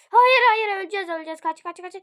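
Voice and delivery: loud high panic, easing slightly; panicked shouting. Real, not acted. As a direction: yell for the team to run. About to die in game.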